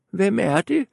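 put-on high frail old woman. A narrator's reading. Grandmother calls through the door, high old voice. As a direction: quaver it like a granny. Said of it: "spurgte den gamle".

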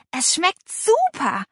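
high, stretched-out relish; excited call. A narrator's reading. Girl raving about the jelly, excited. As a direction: draw it out with delight. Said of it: "rief Paula".

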